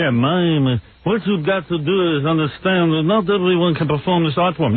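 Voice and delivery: a hint of a French mime lecturing; French accent. Host mocks Marceau's lectures in a light French accent. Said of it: "And he talks like this. In the French".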